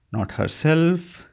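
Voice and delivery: slow, halting finish; hesitant. A narrator's reading. Frank hesitantly finishing a hard sentence about his wife, slow. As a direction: ease out the last words carefully. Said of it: "he paused again".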